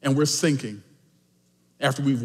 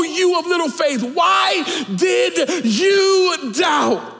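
high, impassioned proclamation, stretched at the end; full-force preaching; 11 semitones higher, 5 dB louder, slower than usual. Real, not acted. Preacher tells the church of Jesus's rescue, full-force preaching. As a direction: preach it at the top of the range, building to a slow shout.

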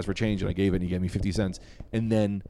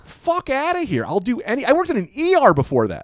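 higher, louder, fast indignant rant; heated rant; 10 semitones higher, 7 dB louder than usual. Real, not acted. Comic venting about a manager who yelled, heated and fast. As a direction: let the outrage spill out quickly. Ranting about the bad job.

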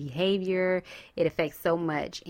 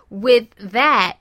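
laughing; 8 semitones higher, 9 dB louder than usual. Real, not acted.

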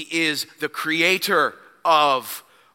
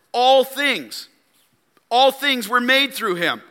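All raised and hit hard in All things; stress on all things; 8 semitones higher, 4 dB louder than usual. Shown as a demonstration. Preacher quoting verse three, stressing All. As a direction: lean into All each time. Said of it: "notice the wording".

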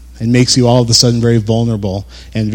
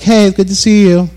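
mock cheery greeting; 8 semitones higher than usual. Mock friendly supermarket greeting.

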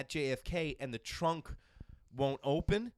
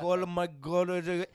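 driver impression; 5 semitones higher, 6 dB louder, much slower than usual. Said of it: "like Aladdin and was like".

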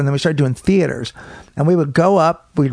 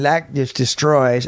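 normal talking voice

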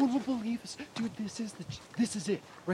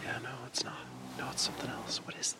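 whispering; 6 semitones lower, 7 dB quieter, faster than usual. Acted. Sneaking into dark woods.

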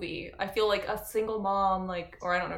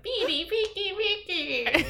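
high cackling witch voice; old witch voice; 10 semitones higher than usual. Pretend. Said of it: "old witch voice".

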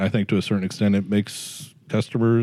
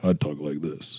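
lightly faked lower radio voice; mock deeper announcer tone; put-on low voice; 5 semitones lower, 8 dB quieter, slower than usual. Shown as a demonstration. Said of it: "You just told me that I sound like this".

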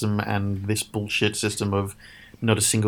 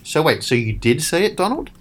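much higher, mocking disbelief on the name; incredulous jab; 6 semitones higher than usual. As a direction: call him out with a sneering rise. Mocking Trump's contradiction.

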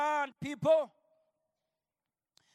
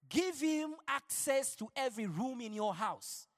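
ordinary delivery

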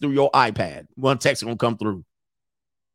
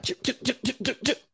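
popping stab noise; 10 semitones higher, 8 dB quieter than usual. Retold or imitated. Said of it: "sound like something pop in a balloon".

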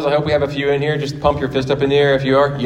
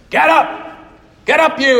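high, strained trainer's yell; put-on old trainer urging his fighter up; Mickey impression; 13 semitones higher than usual. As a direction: holler at a downed boxer. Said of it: "He's like".

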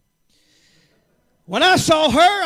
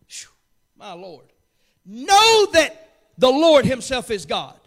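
normal talking voice

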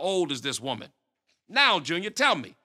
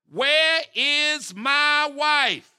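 louder, higher, slow demand; angry demand; 7 semitones higher, 6 dB louder, much slower than usual. Acted. Pastor demanding his wife's whereabouts, slow angry demand. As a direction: drag out each word in anger.

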